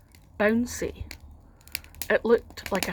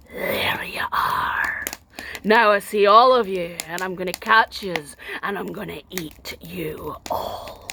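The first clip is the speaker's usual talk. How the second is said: low unvoiced whisper of a waking giant; giant's menacing whisper; 4 semitones lower than usual. Giant waking to find the girl on his belly, menacing whisper. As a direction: hiss the threat slowly. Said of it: "he cried out".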